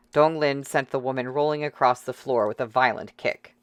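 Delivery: ordinary delivery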